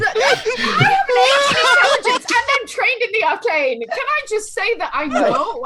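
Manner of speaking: loud, high, laughing argument; loud protest. Real, not acted. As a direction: shout your case over the table.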